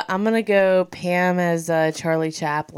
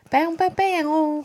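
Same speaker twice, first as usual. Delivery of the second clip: sad trombone imitation; 11 semitones higher, much slower than usual.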